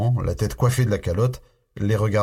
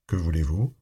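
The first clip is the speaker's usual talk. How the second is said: resonant voice; 4 semitones lower, 3 dB quieter than usual. A narrator's reading. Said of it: "surpris du timbre sonore".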